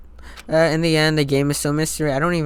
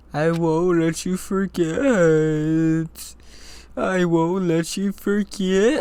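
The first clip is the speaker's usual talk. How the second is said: very slow, stretched eerie refrain; spooky, wailing repetition; haunting ghostly voice; 4 semitones higher, much slower than usual. Acted. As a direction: drag out each word like a haunting spirit. Repeats haunting game threat.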